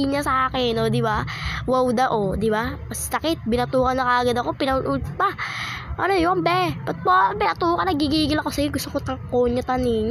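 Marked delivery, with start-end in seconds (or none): 5.1-7.9 high, quick protest; mock surprise. Real, not acted. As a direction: playfully ask why she hit you. Mock complaint to cousin. (gulat na pabirong reklamo)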